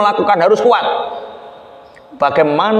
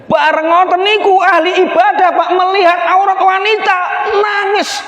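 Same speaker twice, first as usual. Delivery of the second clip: high, rising preacher's cry; impassioned preaching; 12 semitones higher than usual. A narrator's reading. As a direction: climactic sermon outburst as he weeps. Preacher dramatizes the pious man weeping.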